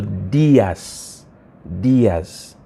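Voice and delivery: Diaz with a long, stressed I, DEE-as. Shown as a demonstration. Teacher shows students the accent, Diaz stressed on I. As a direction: lean on the I in Diaz. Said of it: "the accent is on the I".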